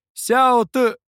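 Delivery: loud, high call cut short. A narrator's reading. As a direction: shout the name and get stopped mid-word. Scribe calls name, cut off.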